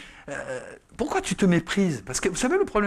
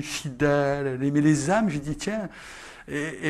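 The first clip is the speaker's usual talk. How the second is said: ordinary talk, nothing marked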